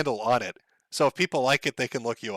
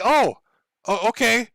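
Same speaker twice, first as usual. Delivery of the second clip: higher, lightly acted stunned guard; mock taken aback; 7 semitones higher, 5 dB louder, slower than usual. Security guard stunned by the man's answer, mock taken aback. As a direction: imitate a guard caught off balance.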